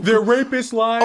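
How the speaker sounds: mock Trump rally voice.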